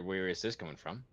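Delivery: usual speaking voice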